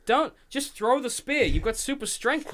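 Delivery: loud, high exasperated yell at a dumb hero. Retold or imitated. Podcaster yelling at Superman on screen, exasperated. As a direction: yell at him like he's an idiot.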